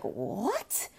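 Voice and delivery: normal talking voice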